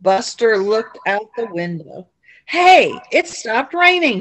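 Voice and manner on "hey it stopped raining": higher, slow and louder call; excited cry. A narrator's reading. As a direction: call out happily. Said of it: "he cried".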